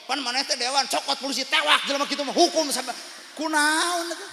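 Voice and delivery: loud, high, indignant scolding; building from heated protest to a loud high demand; indignant outburst. Acted. Councillor's family urging him to have the culprit arrested, indignant. Acted angry reply to being splashed.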